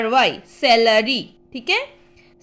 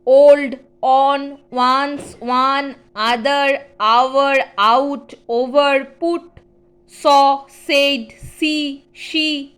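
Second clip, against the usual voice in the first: each word read slowly and clearly, one at a time; word drill; 4 dB louder, much slower than usual. Shown as a demonstration. Teacher reading useful words aloud to the class, clear and measured. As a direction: call out each word firmly for the class to repeat.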